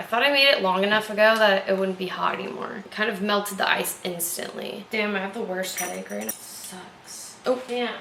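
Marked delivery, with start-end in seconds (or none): none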